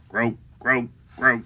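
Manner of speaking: three short, separate frog croaks. Acted. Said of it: "What do the frogs say?".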